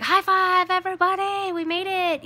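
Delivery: high, loud cheering high five; excited cheer. Retold or imitated. Mom cheering her kids after making it through. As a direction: cheer the kids on like you won. Celebrating after hard church Sunday.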